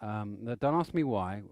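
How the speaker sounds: usual speaking voice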